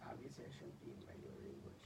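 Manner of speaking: retelling what he thought, in a whisper. Retold or imitated. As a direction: whisper it like a private smug thought. Retold inner thought, whispered.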